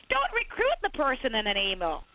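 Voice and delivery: usual speaking voice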